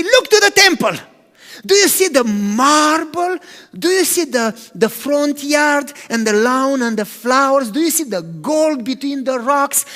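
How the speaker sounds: proud admiring. Retold or imitated. Disciples showing Jesus the temple's gold, proud and loud. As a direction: point out each splendor with pride.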